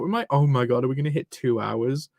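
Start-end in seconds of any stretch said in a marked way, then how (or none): none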